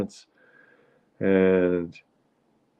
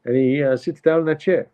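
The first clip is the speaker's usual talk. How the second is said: higher-pitched New York wife impression; complaining mother; 7 semitones higher than usual. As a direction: air a grievance about the husband. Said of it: "She says".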